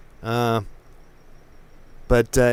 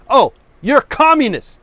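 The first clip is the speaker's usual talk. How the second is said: mocking quote; 9 semitones higher, 5 dB louder than usual. Mocking what opponents say.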